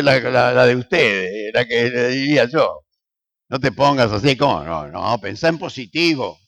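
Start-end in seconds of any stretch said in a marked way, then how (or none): none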